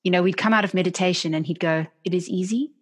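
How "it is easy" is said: calm, even statement of fact; teacher's calm assurance. Retold or imitated. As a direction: say it like a settled truth, falling. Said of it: "it was the way he said it".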